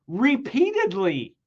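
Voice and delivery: high and slow, every word weighed; dramatic slow reading; slow emphasis. Shown as a demonstration. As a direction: linger on it for effect. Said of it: "Next word".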